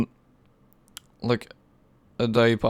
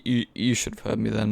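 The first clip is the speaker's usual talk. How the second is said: ordinary delivery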